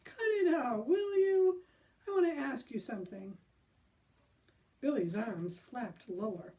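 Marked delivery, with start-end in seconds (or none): 0.0-3.4 nervous hearty call. Acted. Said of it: "hearty, quavering voice".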